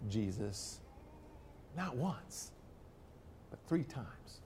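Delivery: very soft, almost breathed; preacher hushing the final count; hushed emphasis. Acted. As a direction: drop the voice right down to land it. Sermon climax, dropped voice.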